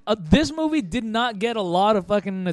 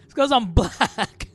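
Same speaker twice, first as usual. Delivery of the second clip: laughing; 5 semitones higher, 4 dB louder, slower than usual. Retold or imitated. Continuing the quote, laughing.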